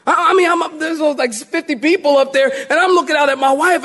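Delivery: high-pitched, agitated retelling; freaking out. Retold or imitated. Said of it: "I'm freaking out".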